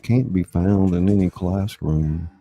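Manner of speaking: measured storybook narration; earnest closing narration of a mashup story. A narrator's reading. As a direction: read it like the closing page of a tale.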